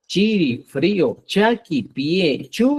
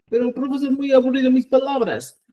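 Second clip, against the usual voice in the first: high-pitched mock student complaint; put-on higher student voice; complaining student; 8 semitones higher than usual.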